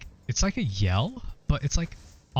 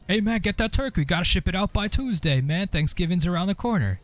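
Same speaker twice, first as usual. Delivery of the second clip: much higher, hammed-up coworker banter; goofy coworker voice; 9 semitones higher than usual. Teasing coworker over the phone, in a much higher hammed-up voice. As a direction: play it as a mock-cheery coworker bit to mess with the caller. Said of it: "and I'd be like".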